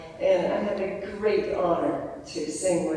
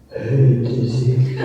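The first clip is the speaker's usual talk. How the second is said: low, louder, luscious hello; 6 semitones lower, 8 dB louder, slower than usual. Retold or imitated. Singer imitating Monica's greeting to Dizzy, low and luscious. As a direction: pour on a lush low voice. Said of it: "it was so luscious".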